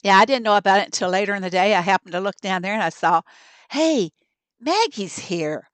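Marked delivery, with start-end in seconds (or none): none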